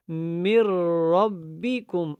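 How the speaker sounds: من ربکم read slowly as "mir-rabbikum"; merged, deliberate recitation of من ربکم; silent noon. Shown as a demonstration. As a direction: drop the n of من into a doubled r. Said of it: "نون کو جو ہے وہ ہم نے نہیں پڑھنا".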